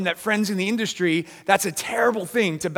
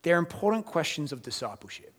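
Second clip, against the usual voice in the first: usual speaking voice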